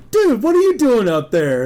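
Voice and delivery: high, loud call from a window. Retold or imitated. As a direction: holler down at a friend in the street. Friend calls out the window.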